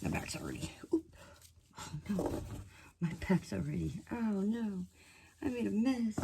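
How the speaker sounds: strained grunts while bending over; groaning under back strain; grunting and groaning. Real, not acted. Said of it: "Sorry, I'm grunting, moaning, and groaning".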